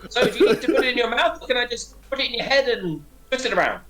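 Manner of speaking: high, animated questioning voice; bemused character voice. Acted. Asking the creature how it drinks, high animated voice. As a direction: ask it high with a touch of laughter. Game master voices figure, laughing.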